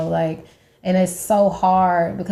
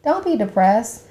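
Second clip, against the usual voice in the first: mock dismissive advice; 6 semitones higher than usual. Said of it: "I was like".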